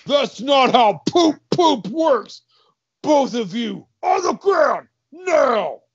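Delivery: loud, high barked orders; yelling guard. Acted. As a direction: shout orders as an outraged guard. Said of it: "A guard walks in and yells".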